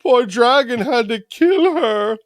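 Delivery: high, drawn-out mock wail; mock pity. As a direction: whine with exaggerated sympathy for the dragon. Mocking viewers who pity the dragon.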